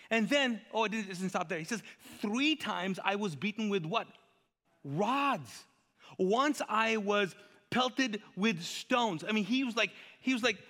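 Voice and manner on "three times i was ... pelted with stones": high, slow, indignant recounting; Paul's rant. Acted. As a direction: stretch out each hardship as a grievance. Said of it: "Paul on a rant".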